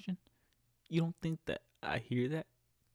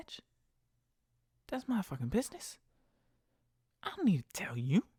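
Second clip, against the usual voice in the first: higher, sassy put-down; blunt retort; 7 semitones higher than usual. Retold or imitated. Driver retorting to nosy passenger about money, sassy. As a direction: snap back with attitude.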